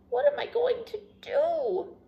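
high, plaintive question; sniffly. A narrator's reading. Douglas asking Rabbit what to do, sniffly high voice. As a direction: whine it high, close to tears. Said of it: "sniffed Douglas".

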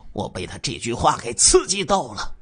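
ordinary delivery, nothing marked

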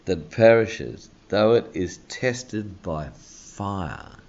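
slow, deliberate reading of the verse; a measured pulpit reading from One Peter; slow scripture reading. Acted. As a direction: read it out slowly with weight.

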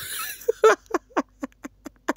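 bursts into high-pitched laughter. Real, not acted. Cracking up at a fan's comment, high laugh.

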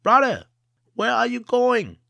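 high, loud call up from the tree. Acted. Jia tied to a tree hails a passing merchant, high loud call. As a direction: hail the passing merchant loudly. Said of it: "Jia called out to him".

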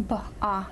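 "B, ah" broken into two sounds; separate sounds. Shown as a demonstration. Tutor breaks it down for the student, "B, ah" as separate sounds. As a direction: say "B" and "ah" apart, one sound each. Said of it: "what two sounds do we have".

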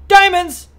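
excited cry. Real, not acted. Finds diamonds while playing.

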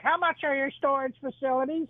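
put-on little old lady. Old lady calling about storage, high mock granny voice. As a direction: squeak it up like a sweet old granny. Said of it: "she's like".

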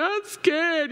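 put-on high, plaintive child's voice; mock small-child whine; scared little child. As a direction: imitate a frightened kid at bedtime. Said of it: "When your little one is in the room".

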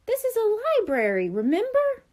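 high-pitched chiding question; pointed reminder. A narrator's reading. The Brain reminding Buster of library rules, chiding. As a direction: chide a friend who forgot the rules.

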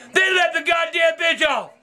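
ordinary talk, nothing marked

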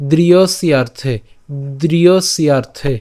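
দৃঢ়স্যার্থে drawn out very slowly; slow recitation. Shown as a demonstration. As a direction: stretch দৃঢ়স্যার্থে syllable by syllable. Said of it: "প্রথম লাইনটা যদি আমি আরেকবার বলি". (খুব ধীরে দৃঢ়স্যার্থে)

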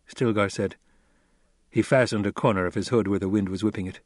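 ordinary delivery

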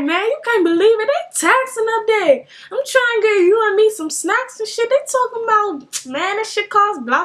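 high-pitched imitation of a complaining man; indignant griping. Stranger back from the snack car about prices, indignant. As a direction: gripe high and outraged, hammed up.

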